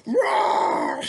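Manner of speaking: mock monster roar; hammed-up roar.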